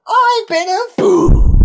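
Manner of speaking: very high, loud, drawn-out shout; desperate yell. A narrator's reading. Man yelling to people on the other platform, desperate shout. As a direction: yell it out at full pitch. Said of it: "he turned and yelled to them".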